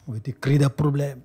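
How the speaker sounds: ordinary talk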